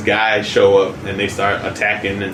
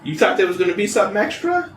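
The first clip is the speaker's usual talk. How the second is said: teasing Harley voice; 10 semitones higher than usual. Retold or imitated. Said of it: "she's like".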